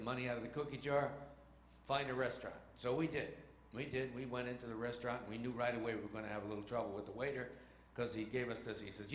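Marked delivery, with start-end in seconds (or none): none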